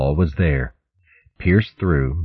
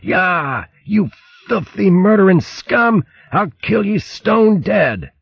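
furious yell. Acted. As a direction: scream it as you attack a hated enemy. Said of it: "yelling as he locked a paw".

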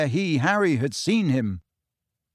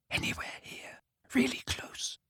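whispering; 12 semitones higher, 11 dB quieter than usual. A narrator's reading. Searching in hushed dark.

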